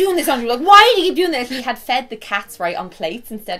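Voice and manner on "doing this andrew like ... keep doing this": loud, fast, fed-up yell; exasperated yell. Retold or imitated. Mother yelling at her partner over the cat plates, exasperated. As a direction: snap at him for doing it again.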